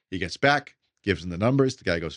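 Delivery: ordinary talk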